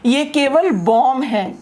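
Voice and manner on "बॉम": "बॉम्ब" said as bom; silent final B. Shown as a demonstration. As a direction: end "बॉम्ब" on M, no B. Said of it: "इसका कोई sound नहीं है".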